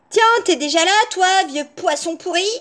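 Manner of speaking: put-on high, brash girl voice; cheeky insult. A narrator's reading. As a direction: toss the insult out rudely. Rude girl insults the wolf.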